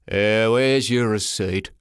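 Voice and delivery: slow, growling official; gruff porter. Acted. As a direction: drag it out like a surly clerk. Same gruff porter speaking.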